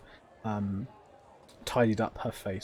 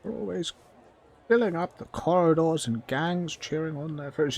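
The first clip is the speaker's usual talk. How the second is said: high goblin grumble; grumbling goblin; 7 semitones higher than usual. Acted. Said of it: "this goblin begins to mutter to herself".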